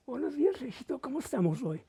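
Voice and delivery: lightly voicing a doting grandma; grandma's greeting.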